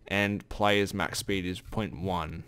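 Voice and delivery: usual speaking voice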